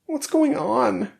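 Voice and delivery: very high, slow, plaintive question; high, drawn-out voice of a wounded character; bewildered character. A narrator's reading. Wounded Ruby asks her rescuers, bewildered.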